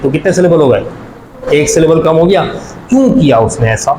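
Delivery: ordinary talk, nothing marked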